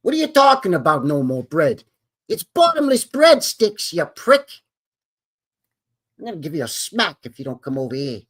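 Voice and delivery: outraged mobster Pesci at the waiter; Joe Pesci impression. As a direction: yell about the bottomless breadsticks as Joe Pesci. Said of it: "You better do that Joe Pesci voice".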